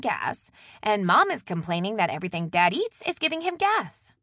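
bubbly sister unloading family news; chatty younger sister. Acted. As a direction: gossip brightly down the line, loud and high. Maddie's phone chatter in narrated story.

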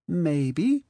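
put-on high, sweet, coaxing party girl. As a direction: bat your lashes with the words. Marley teasing her ex.

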